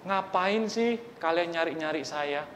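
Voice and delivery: put-on rude child. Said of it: "dia malah".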